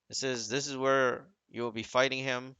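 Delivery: ordinary delivery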